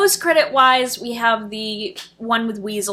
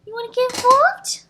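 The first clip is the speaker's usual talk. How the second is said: much higher playful pet voice; 14 semitones higher, 3 dB louder than usual. Real, not acted. Asking her cat, high playful baby talk. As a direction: ask the kitty up high.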